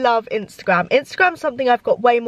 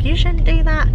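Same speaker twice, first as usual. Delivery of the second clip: higher, louder scolding voice; mocking haters; 5 semitones higher, 5 dB louder than usual. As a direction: mimic a preachy critic. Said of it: "gonna come at me like".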